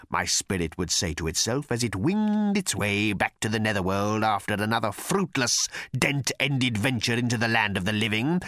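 plain reading voice

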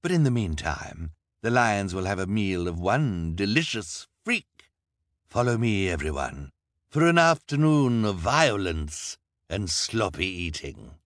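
villain leading a cruel show; Count Olaf gloating. A narrator's reading. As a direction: promise violence with glee. Olaf voiced.

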